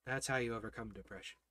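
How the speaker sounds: low, very soft, with long pauses; hushed preaching. Real, not acted. Preacher telling the congregation how to overcome depression, hushed preaching. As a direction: drop right down and let each phrase settle.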